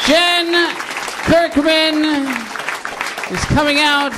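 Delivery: high, loud drawn-out announcement; announcer call. Acted. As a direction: boom the name like a ring announcer. Host introduces guest.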